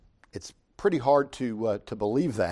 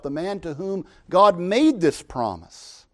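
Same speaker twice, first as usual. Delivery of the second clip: ordinary delivery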